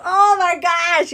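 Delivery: slow, high, loud oh my gosh; a fed-up, slow "oh my gosh"; exasperated. Retold or imitated. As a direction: drag it out in disbelief. Exasperated reaction to his texts.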